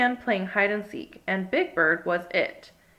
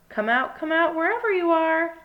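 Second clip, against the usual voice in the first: calling out; 7 semitones higher, 4 dB louder than usual. A narrator's reading. Calling for hidden friends.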